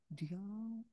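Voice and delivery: the word "Diam." dropped almost to nothing; hushed. Acted. Speaker showing how termites work in silence, nearly silent. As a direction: breathe "Diam." so it barely carries.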